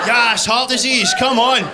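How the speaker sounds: loud, high, slow celebratory shout; mock cheer. Cheering the applause for a fry-up, mock triumphant shout.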